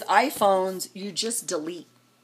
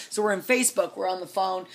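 normal talking voice

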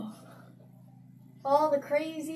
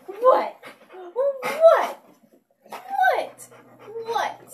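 rising high, louder squeals of what; playful pet squeals; 9 semitones higher, 6 dB louder, much slower than usual. Real, not acted. Owner squeals at her dog in baby talk.

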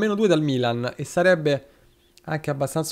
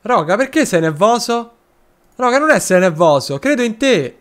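coaxing encouragement; 5 semitones higher, 4 dB louder than usual. Real, not acted. Coaxing a nervous virtual player.

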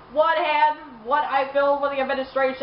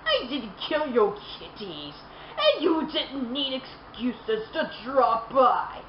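softer, slow, oily sincere Joker; a hint of creepy earnestness; sickening sincerity; 4 dB quieter, slower than usual. A narrator's reading. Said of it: "spoke with sickening sincerity".

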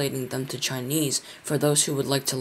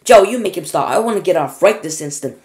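high, loud, alarmed order; raised-voice panicky command; yelled demand; 4 semitones higher, 11 dB louder than usual. A narrator's reading. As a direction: shout urgently to be let off. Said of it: "yelled Grandma Josephine".

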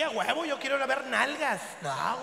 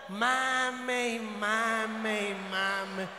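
stretched slowly, lower pitch; drawn-out nagging; 5 semitones lower, much slower than usual. Acted. Husband mocking his wife's constant nagging, slow and drawn out. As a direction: drag the words out like endless nagging.

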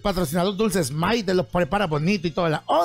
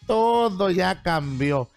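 ordinary talk, nothing marked

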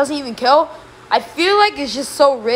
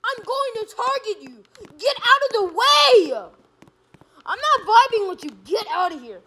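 high, whining outrage; angry threat; 9 semitones higher than usual. Real, not acted. Player vowing to target another, indignant. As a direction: warn them off in a raised, indignant pitch.

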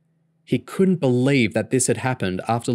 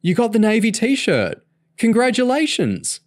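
much higher, louder delight; excited; 14 semitones higher, 5 dB louder than usual. Acted. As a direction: burst out with the good news. Boyfriend congratulates girlfriend.

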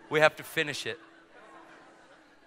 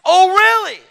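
very high, loud indignant shriek; real loud; 17 dB louder, slower than usual. Retold or imitated. Flight attendant answering preacher back, real loud. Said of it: "Real loud".

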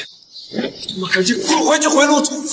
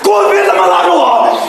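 furious yell, louder and higher; angry shout; 8 semitones higher, 5 dB louder than usual. Acted. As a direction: shake him off with a furious bark. Shoving off a restraining friend. (愤怒地吼叫)

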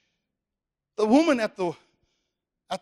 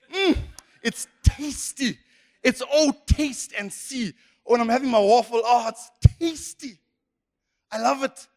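hammed-up relish; 5 semitones higher than usual. Having a bunny chow or waffle, acted savouring delight. Acting out enjoying favourite food.

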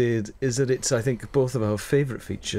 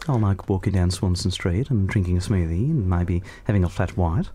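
lightly voiced middle-class Melbourne Australian accent; a hint of Melbourne, lower and relaxed; a gentle middle-class Melbourne Australian voice; 4 semitones lower than usual. Shown as a demonstration. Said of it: "middle-class Melbourne one".

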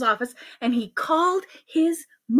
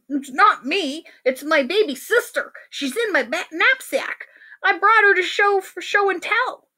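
put-on higher, pleading kid voice; protesting schoolboy; 7 semitones higher than usual. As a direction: defend yourself like a child. Ben defends himself to teacher.

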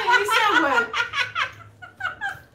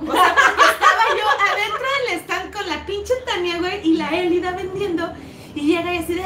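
ordinary delivery

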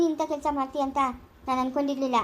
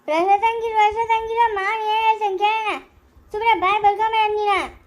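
higher, louder furious scolding; angry shout; 5 semitones higher, 4 dB louder than usual. Acted. Elder shouting the son into silence, furious. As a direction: shout him down, you won't let him speak.

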